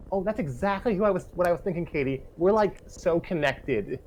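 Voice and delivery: high, gushing best-friend voice; giddy high character voice, slowed on the last line; bubbly girl voice. Acted. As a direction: squeal it like an overexcited schoolgirl.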